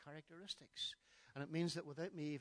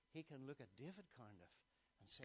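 ordinary talk

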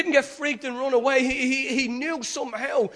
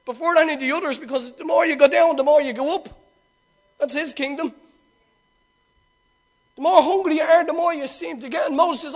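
ordinary talk, nothing marked

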